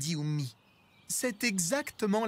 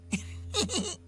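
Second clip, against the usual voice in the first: high cat giggle; 10 semitones higher than usual. A narrator's reading. Binti laughs.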